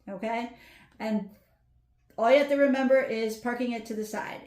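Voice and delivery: ordinary delivery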